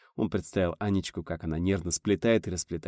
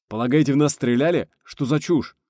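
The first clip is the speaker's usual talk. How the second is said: raised, louder incredulous questions; haughty scorn; 6 semitones higher, 7 dB louder than usual. A narrator's reading. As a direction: dismiss it as absurd, snootily. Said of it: "надменно фыркнул Ксенфомалити". (надменное возмущение)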